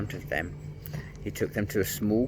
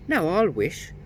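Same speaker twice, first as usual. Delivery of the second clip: calling down the well; 9 semitones higher than usual. A narrator's reading. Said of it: "she called down the well".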